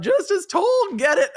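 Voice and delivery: cheering. Real, not acted. Cheering the judge on.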